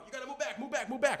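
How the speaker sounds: high barked orders to move back; mock guard yelling move back; security guys ordering. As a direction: bark like a bodyguard clearing a crowd. Secret Service pushing crowd back.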